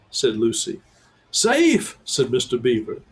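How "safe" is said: high shocked squeak; can't believe the question; incredulous. A narrator's reading. Beaver incredulous at Lucy, high and shocked.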